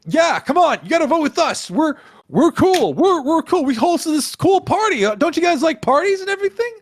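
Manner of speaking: high, eager persuading voice; persuasive high voice. Acted. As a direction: coax them eagerly. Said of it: "and he's like".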